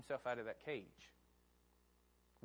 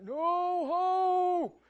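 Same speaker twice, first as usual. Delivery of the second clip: high, loud wail of no hope; high-pitched shouting lament; despairing old man; 12 dB louder, much slower than usual. Retold or imitated. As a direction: cry out like a caged old man who gave up. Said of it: "he's like".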